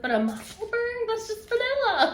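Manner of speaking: high, laughing mock disbelief; mock scoff. Teasing a friend about her pattern choice, high laughing scoff. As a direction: scoff like it's the plainest pattern. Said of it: "and I was like".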